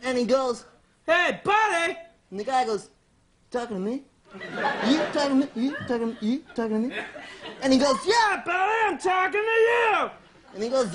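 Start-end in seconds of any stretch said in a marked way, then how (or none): 1.1-2.0 lightly voiced officious hail; bossy forest ranger. Ranger calls again. 3.5-6.9 small, unsure questioning; lightly voiced bewildered guy. Acts meek man answering ranger. 8.1-10.1 high-pitched comic bellow; confrontational forest ranger. Acted. Acts ranger confronting man.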